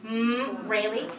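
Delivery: ordinary talk, nothing marked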